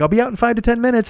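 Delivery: niece's promise. Retold or imitated. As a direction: rattle it off high like the niece. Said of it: "She's like".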